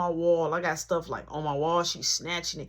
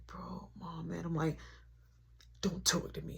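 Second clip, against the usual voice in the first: quiet hushed exchange between brothers; hushed sibling whisper; 4 semitones lower, 13 dB quieter than usual. Retold or imitated. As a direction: keep it under mom's hearing. Brothers whispering during chaos.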